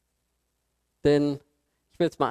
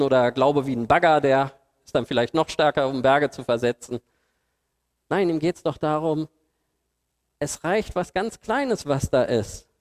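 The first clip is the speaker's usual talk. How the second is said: normal talking voice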